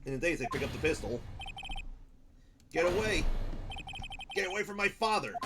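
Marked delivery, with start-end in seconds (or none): 2.7-5.3 desperate shout. Acted. Boy with the pistol, yelling at the attacker. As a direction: yell to protect his father.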